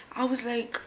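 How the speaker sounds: ordinary talk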